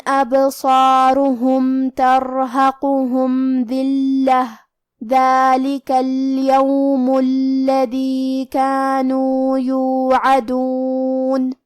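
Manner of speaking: slow melodic tilawat with long drawn vowels; Quranic recitation. Acted. Teacher recites the whole passage to students, melodic chant. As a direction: chant the verses slowly, like in prayer.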